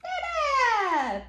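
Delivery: high Dada sliding down; playful drawn-out high cheer; exclaimed refrain. Acted. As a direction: sing it out high for baby. Parent cheers book's refrain to child.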